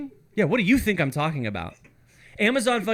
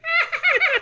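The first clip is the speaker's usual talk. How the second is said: very high, cute little giggle; imitated giggle; 3 dB louder than usual. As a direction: mimic a tiny girlish laugh. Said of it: "He's like".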